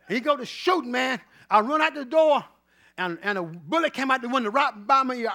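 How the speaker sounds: loud, driving sermon story, building to the shot; fired-up preaching. Acted. Pastor telling his flock about the club shooting, in a loud fired-up preaching voice. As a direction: preach it to the congregation like a revival.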